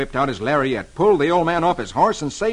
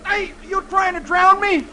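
put-on much higher startled boy; 4 dB louder than usual. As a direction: yelp it like a scared kid. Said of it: "The boy exclaims".

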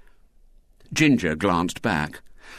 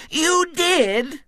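put-on very high, louder little-girl outcry; crying out insistently; 5 dB louder than usual. A narrator's reading. Girl contradicting William's denial, shrill little cry. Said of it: "cried Violet Elizabeth".

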